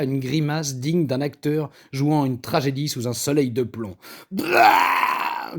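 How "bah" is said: low gagging grunt of disgust. A narrator's reading. Paul tasting the mint stew, low disgusted grunt. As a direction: spit out the taste with a grunt. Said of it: "grogna-t-il".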